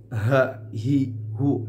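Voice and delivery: H hi hu pushed hard from the chest. Shown as a demonstration. Teacher showing the wrong heavy h. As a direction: push H hi hu from the chest. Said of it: "itu tidak tepat".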